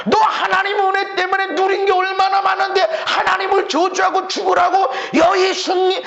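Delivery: high, loud sermon at full force; impassioned preaching. Real, not acted. Preacher retelling long-suffering endurance to the congregation, impassioned.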